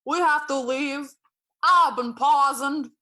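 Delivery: put-on dad at Disney World, accented dread; dad's accent. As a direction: announce doom in a thick Romanian accent. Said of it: "he turned to me and was like".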